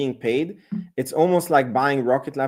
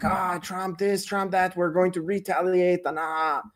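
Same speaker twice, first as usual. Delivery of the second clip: mocking whine; 6 semitones higher, 5 dB quieter than usual. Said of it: "but then you're like".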